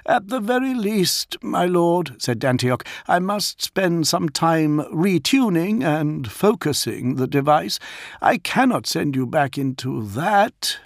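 polite high-pitched Warsmith addressing his lord; Dantioch's voice. A narrator's reading. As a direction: speak up high and careful as the loyal Warsmith.

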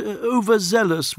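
hesitant, carefully picked word; delicate euphemism. Acted. Envoy softens news of deaths, delicately hesitant. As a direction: tiptoe onto the word diplomatically.

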